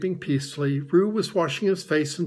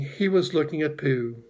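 plain reading voice

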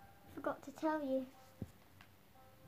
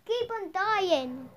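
loud, slow, higher shout; slow yell; 4 semitones higher, 11 dB louder, slower than usual. Real, not acted. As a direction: drag out a furious yell. Yelling at attacking zombies.

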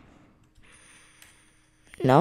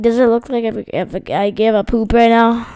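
normal talking voice

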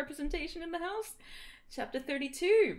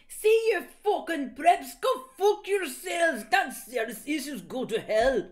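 angry rant; 4 semitones higher, 8 dB louder than usual. Acted. Author's note ranting at preps, loud and shouted. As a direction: scream it at the haters.

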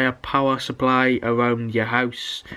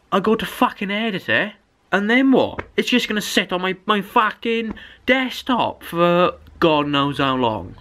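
ordinary talk

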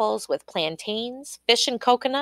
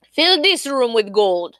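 firm, louder order; commanding; 3 semitones higher, 5 dB louder than usual. A narrator's reading. King ordering the queen to make gold, commanding. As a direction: give it as a command. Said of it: "he commanded".

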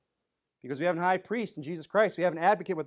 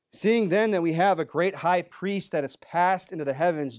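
raised, ringing preacher's reading of scripture; 3 semitones higher, 4 dB louder than usual. Acted. Preacher reading John sixteen to the congregation, raised and ringing. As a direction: proclaim the verses louder and higher. Reads Hebrews four aloud.

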